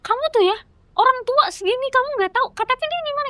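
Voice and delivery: imitating a scolding official, very high-pitched; high, indignant telling-off, acted out; memarahi. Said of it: "Dimarahinnya tuh gini". (menirukan omelan petugas dengan nada tinggi)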